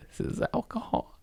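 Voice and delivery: high, squeaky hushed remark; squeaky aside. Real, not acted. Stoned host squeaking about his drink. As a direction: squeak it conspiratorially.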